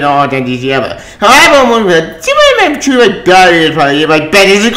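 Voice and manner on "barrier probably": ordinary talk, nothing marked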